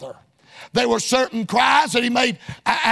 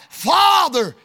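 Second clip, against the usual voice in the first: cried out; 5 semitones higher than usual. Retold or imitated. Jesus on the cross calling to his Father, crying out. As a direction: call the word out to heaven. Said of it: "And he cried out".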